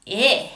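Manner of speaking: "Eh" as a short grossed-out "ih"; yucky short I. Shown as a demonstration. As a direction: say "Eh" like the i in igloo, with disgust. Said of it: "short I is very yucky".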